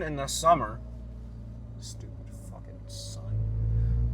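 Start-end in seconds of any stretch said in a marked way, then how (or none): 1.8-4.1 hushed, breathy grumble under the breath; slow whispered curse at the sun. Real, not acted. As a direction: mutter it almost soundlessly, slow and disgusted. Cursing the summer heat.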